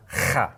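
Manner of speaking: "kha" with a rasping, scraped kh; harsh fricative kh, as in Scottish loch, on "kha"; grating kha. Shown as a demonstration. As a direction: grate the back of the throat on "kha". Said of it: "It's not grating against anything".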